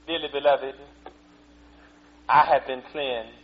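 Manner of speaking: low, slow, stiff churchy voice; a mock dignified announcement, held back; prim, proper voice. Preacher mocking a stiff formal testimony, low and slow. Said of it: "he stood up and said".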